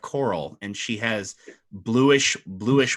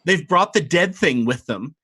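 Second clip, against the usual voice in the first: higher, scornful mermaid voice; sneering mermaid sister; 7 semitones higher, 4 dB louder than usual. Acted. Vanamira mocks the party to her sister, higher and sneering. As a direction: sneer at the dead thing they brought. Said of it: "She says".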